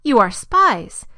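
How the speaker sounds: loud, drawn-out accusation; accusing. A narrator's reading. Joseph confronting his brothers as spies, loudly accusing. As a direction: hit it hard and slow, accusing.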